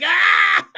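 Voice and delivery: sudden high startled "Ah!"; startled yelp. Retold or imitated. Phone speaks up while driving, startled high yelp. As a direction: let out a high yelp of fright. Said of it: "That was the right voice inflection".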